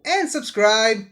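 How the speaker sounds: loud, bright sign-off to viewers; YouTube outro voice. Acted. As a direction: hype up the like and subscribe. Channel outro.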